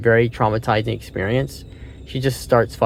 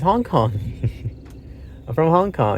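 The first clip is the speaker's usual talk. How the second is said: higher, timid mock reply; the captive answering his killer, higher and frightened; scared answer; 6 semitones higher than usual. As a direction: answer like a terrified man. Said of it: "He's, he's scared".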